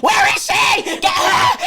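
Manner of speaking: loud, laughing yell of Get her; laughing shout. Retold or imitated. Retold shouting at the door.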